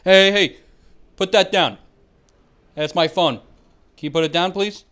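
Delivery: loud retold yell at a phone thief; shouting guy. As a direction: bark it across the gym, loud and firm. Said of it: "The guy says".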